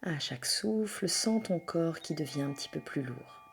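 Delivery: soft, hushed yoga nidra voice with long pauses; guided meditation. Acted. Leads a yoga nidra relaxation.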